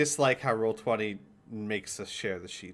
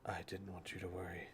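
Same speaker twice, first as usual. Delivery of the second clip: low, hushed fatherly aside; very quiet; 4 semitones lower, 17 dB quieter than usual. Acted. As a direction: say it softly, for one listener only. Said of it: "says very quietly".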